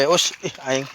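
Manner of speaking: ordinary talk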